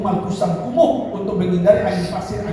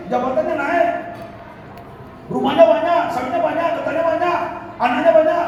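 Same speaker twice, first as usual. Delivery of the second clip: high, insistent sermon delivery; impassioned preaching; 10 semitones higher than usual. Real, not acted. Preacher chides nominal Muslims far from mosque, impassioned preaching. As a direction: ring out each phrase high for the crowd.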